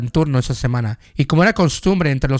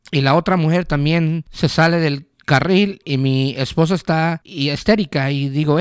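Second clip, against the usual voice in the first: usual speaking voice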